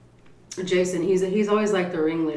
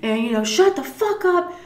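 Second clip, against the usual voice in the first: much higher yelled cussing; imitated yelling; 11 semitones higher, 4 dB louder than usual. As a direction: chew him out at full volume. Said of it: "Brandon's yelling and cussing at him".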